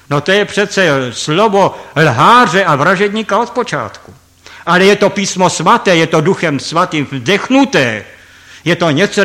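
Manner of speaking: ordinary delivery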